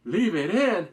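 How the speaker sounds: loud, puzzled repeat of "Leave it in?"; a viewer echoing the order in confusion; baffled echo. Retold or imitated. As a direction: throw the order back in disbelief. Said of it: "And I'm like".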